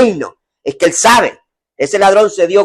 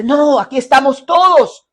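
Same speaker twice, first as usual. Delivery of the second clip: urgent call; 8 semitones higher than usual. Acted. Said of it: "Pablo le dice".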